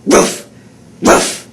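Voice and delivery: loud barking ruff; dog bark. Shown as a demonstration. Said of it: "bark like a dog".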